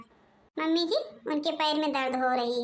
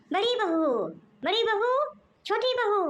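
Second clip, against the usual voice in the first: higher-pitched summons through the house; calling out; 6 semitones higher than usual. Acted. Mother-in-law summons both daughters-in-law, insistent calling out. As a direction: call each one impatiently from afar. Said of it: "mummy जी इतना चिल्ला रही हैं".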